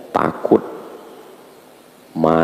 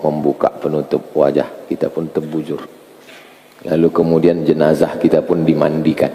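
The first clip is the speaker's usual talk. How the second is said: walk them gently through their own burial; guided contemplation; 8 semitones lower than usual. Acted. Leads a death contemplation.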